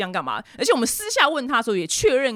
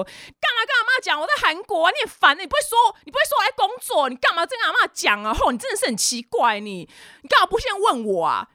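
much higher, sharp scolding outburst; angry scolding; 10 semitones higher than usual. Retold or imitated. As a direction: tear into your sister for telling grandma. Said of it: "就直接就破口大骂".

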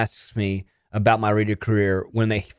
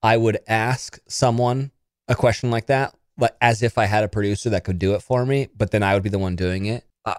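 ordinary talk, nothing marked

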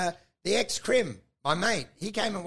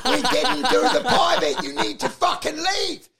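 high, loud laughing shout; laughing yell; 6 semitones higher, 4 dB louder than usual. Retold or imitated. Comedian yelling the pie man off stage. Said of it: "I was yelling".

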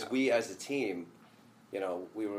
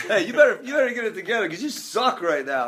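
Tab's tough talk; 9 semitones higher, 10 dB louder than usual. Retold or imitated. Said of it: "that's what makes Tab great".

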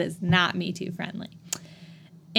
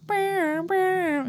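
high car alarm blare; imitated car alarm; 11 semitones higher than usual. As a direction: mimic a car alarm with your voice. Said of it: "car alarm starts going off".

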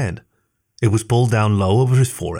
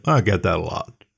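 deadpan; 3 semitones lower than usual. A narrator's reading. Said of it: "In a deadpan voice".

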